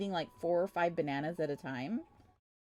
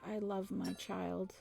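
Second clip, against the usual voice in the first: soft, mock self-convincing repetition; muttered mantra; 13 dB quieter than usual. Parent saying it enough to make it real, muttered mantra. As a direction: chant it under your breath to convince yourself.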